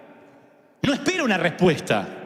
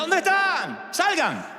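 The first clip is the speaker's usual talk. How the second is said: put-on higher, calling out the hidden kids; playful hide-and-seek call; 7 semitones higher, much slower than usual. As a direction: coax the hidden players out.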